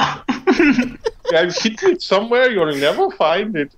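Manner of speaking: high, slow leprechaun voice; taunting stage-Irish leprechaun. Retold or imitated. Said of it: "He was like".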